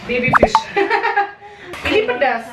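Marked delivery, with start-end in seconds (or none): 0.6-1.8 laugh. Real, not acted. Mother laughs at baby fish.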